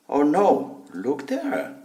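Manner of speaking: ordinary delivery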